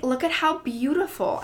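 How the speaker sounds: high-pitched voice of wonder; retold awe, pitched well up; imitated admiring grandmother. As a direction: marvel like a delighted grandmother.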